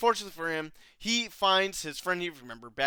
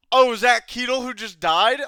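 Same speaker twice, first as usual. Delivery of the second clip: loud, raised comic wondering; mock character voice; 7 semitones higher, 12 dB louder than usual. Wolf squinting at a fallen man, hammed-up curiosity. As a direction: play up a silly puzzled query.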